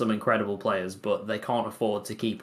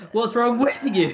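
high, loud, laughing, stress on "with"; imitating the with take; 13 semitones higher, 6 dB louder than usual. Co-host quoting his favourite take, laughing mimicry. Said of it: "My favourite was".

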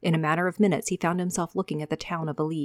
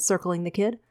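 ordinary delivery, nothing marked

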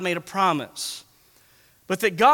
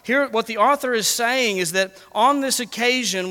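ordinary talk, nothing marked

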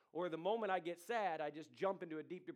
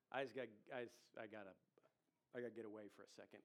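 much lower and softer, fast, talking to himself; muttered to self; 8 semitones lower, 9 dB quieter, faster than usual. Retold or imitated. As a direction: rush it under your breath, overwhelmed. Voicing overwhelmed inner self.

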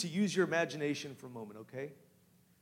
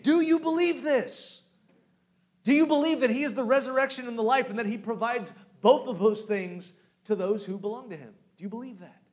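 much higher, louder pressing question; fervent challenge; 7 semitones higher, 7 dB louder than usual. Acted. Pastor challenging the church to believe, fervent.

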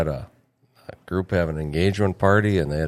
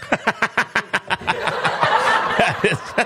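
big laugh. Real, not acted. Laughing at his own joke's punchline.

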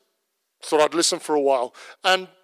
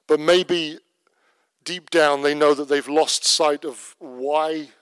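pastoral prayer; 2 semitones lower than usual. Acted. Closing prayer over congregation.

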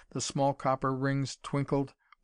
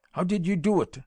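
higher, louder frantic cry; shrill cry; 6 semitones higher, 5 dB louder than usual. A narrator's reading. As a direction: scream the question, losing control. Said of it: "he cried shrilly".

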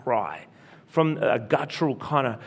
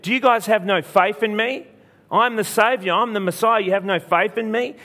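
mock rebuke, pitched up; lightly acted indignant questioning in a higher voice; 6 semitones higher than usual. Jesus rebuking mourners for lack of faith, imitated indignation.

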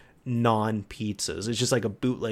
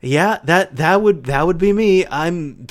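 mom owning up; 5 semitones higher, 8 dB louder than usual. Retold or imitated. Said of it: "she laughed a little bit".

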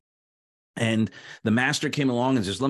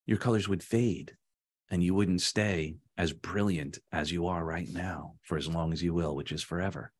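low, soft reassurance; tender master; 8 semitones lower, 7 dB quieter than usual. Acted. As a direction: sink gently into a hushed, low close. Master tenderly concludes to the teacup.

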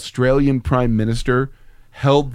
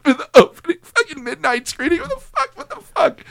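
mock outrage; 14 semitones higher than usual. Outraged rant about the fan.

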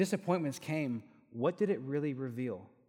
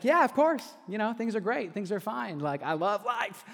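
much higher, louder, over-the-top fake cheer; fake cheerfulness; 8 semitones higher, 7 dB louder than usual. Pastor pretending to his boss that life is great, forced cheer. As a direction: oversell that everything is great. Faking being fine.

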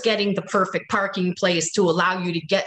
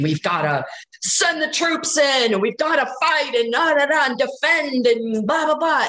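higher-pitched mock hawkish rant; worked up; 6 semitones higher than usual. Retelling a friend's partner's war rant, worked up. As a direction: imitate someone worked up, trailing into blahs. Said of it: "he's all worked up about the war".

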